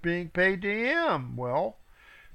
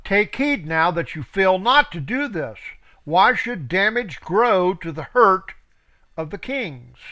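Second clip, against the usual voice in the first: scripture reading; 5 dB louder than usual. Acted. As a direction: read it out like a preacher. Reads scripture aloud.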